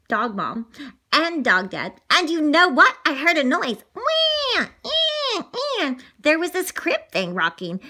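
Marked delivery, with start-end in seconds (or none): 3.9-5.9 put-on high wah wah baby wail; imitated baby cry. Child mimicking the new baby crying high.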